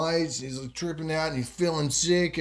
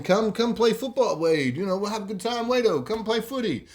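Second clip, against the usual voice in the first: higher-pitched, eager invitation; coaxing call; 6 semitones higher than usual. Retold or imitated. Friends urging mate to play footy, eager coaxing. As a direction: call a mate over to join in.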